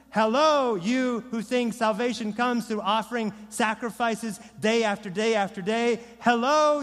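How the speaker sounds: loud, high-pitched confrontational preaching; mock-taunting proclamation. Preacher voicing Peter confronting religious rulers, loud defiant taunt.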